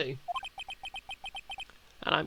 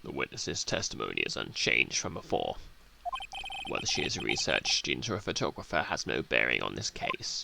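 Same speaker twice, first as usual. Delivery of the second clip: much lower, cold and condescending prosecutor; haughty old prosecutor; 13 semitones lower, 5 dB quieter than usual. Acted. Old prosecutor belittling the witness, low and cold. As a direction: look down your nose at the defense and sneer, in a low voice.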